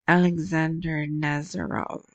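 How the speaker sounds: a half-hearted stab at a Russian accent on the name; attempted Russian accent. Shown as a demonstration. Vlogger tries a Russian name, then a weak Russian accent. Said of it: "Can't do a Russian accent".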